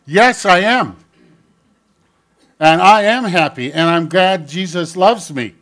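put-on loud, high, eager child answering out; a small boy blurting answers in church, loud and high. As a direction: shout the answers like an excited little boy.

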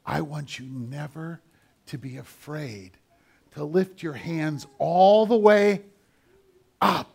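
normal talking voice

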